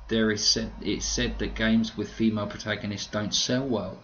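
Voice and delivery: ordinary delivery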